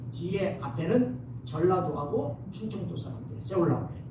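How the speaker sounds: ordinary talk, nothing marked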